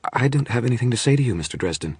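quick, high-pitched refusal; urgent refusal. A narrator's reading. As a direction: shut the visitor out at once. Frightened woman turns visitor away.

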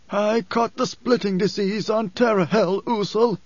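high, strained wheeze of a sick man; wheezing. A narrator's reading. Sick Othame tells Usul of his disease, wheezing. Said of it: "he wheezed".

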